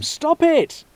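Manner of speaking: put-on a girl shoved, calling out; alarmed call. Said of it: "called Lana in alarm".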